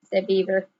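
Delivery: plain reading voice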